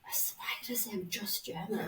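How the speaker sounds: whispered, laughing self-reproach; mock self-reproach. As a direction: imitate him cringing to himself in a whisper. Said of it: "imagine him later just being like".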